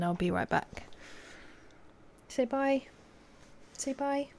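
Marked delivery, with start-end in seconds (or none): 2.3-2.8 high sing-song prompt; pet voice. Real, not acted. Owner prompting the dog, high sing-song. 3.8-4.3 high and soft; pet voice, softer. Real, not acted. Owner coaxing the dog softly.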